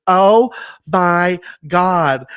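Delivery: drawn-out, loud, high astonishment; retold amazed reaction. Stacy seeing his WrestleMania picture, imitated slow loud amazement. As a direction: imitate being floored, stretching each word. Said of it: "She was like".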